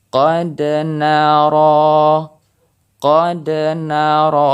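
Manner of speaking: slow "qad nara" with the d bouncing; qalqalah on dal. Shown as a demonstration. Teacher demonstrating qalqalah, bounced dal. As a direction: let the dal in "qad" rebound. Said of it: "qad ini terdengar pantulan".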